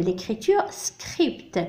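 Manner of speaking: very slow, soft "l'écriture script"; careful teacher model; slow model phrase. Shown as a demonstration. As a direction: say it slowly for the class. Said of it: "بكتابة الكتاب".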